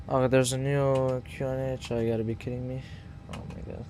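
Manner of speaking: ordinary talk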